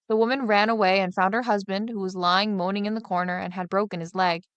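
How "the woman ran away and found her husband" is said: ordinary delivery, nothing marked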